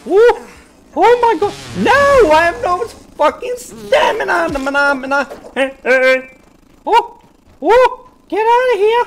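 high, loud, frantic outburst; panicked shouting. Real, not acted. Player fleeing with no stamina left, panicked shouting. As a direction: shriek and babble as you flee.

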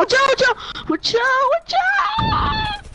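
urgent warning. Real, not acted. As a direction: warn a teammate in panic. Warning teammate in game.

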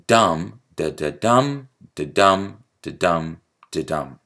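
opening "dum" stressed, two light "da", then da-DUM; irregular meter demo. Shown as a demonstration. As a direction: beat out "dum, da, da, dum" with heavy dums. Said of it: "So it reads like".

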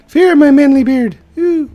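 mock-menacing high falsetto; squeaky silly voice. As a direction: boast loudly in a ridiculous high voice. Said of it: "have to talk like this".

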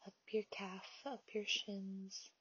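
normal talking voice

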